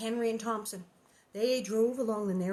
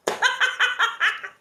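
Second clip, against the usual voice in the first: high laugh; 12 semitones higher, 10 dB louder than usual. Real, not acted. Reader cracks up after voicing Nellie, high laugh.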